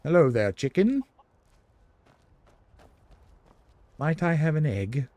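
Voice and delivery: a hint of a bad British accent, lightly put on; terrible British accent. Asking a chicken for an egg in a game, mock British accent. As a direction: playfully fake a posh British politeness. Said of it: "My terrible British accent".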